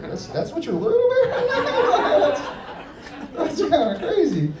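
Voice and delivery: words breaking up into high laughter; laughing. Retold or imitated. Comic retelling his reply to a guy's answer, laughing. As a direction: crack up mid-sentence.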